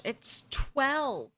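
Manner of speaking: said through a yawn. Real, not acted. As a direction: yawn while you speak. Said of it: "Sorry, I keep yawning".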